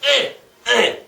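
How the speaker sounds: high, loud stabbing grunts; sharp effortful jab sounds; stabbing noises. Shown as a demonstration. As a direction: act out two sword jabs vocally. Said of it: "like this".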